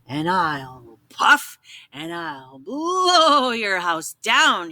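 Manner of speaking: wolf gloating at the pig; laughing wolf. Acted. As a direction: draw out the threat slowly. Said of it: "he cried".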